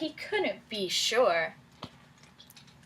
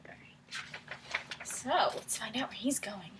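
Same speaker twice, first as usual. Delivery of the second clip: soft unvoiced whisper; 10 dB quieter, slower than usual. Real, not acted. Teacher whispering along with her pupils. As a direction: keep it hushed.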